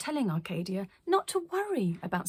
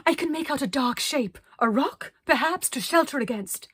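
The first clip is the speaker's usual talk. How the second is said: eccentric lady calling; 6 semitones higher, 6 dB louder than usual. Acted. Arcadia calling through storm.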